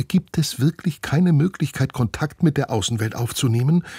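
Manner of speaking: high, incredulous question; can't believe there's no way out; astonished. A narrator's reading. Teacher asks the director in disbelief, high and astonished. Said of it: "fragt Frau Susemihl erstaunt".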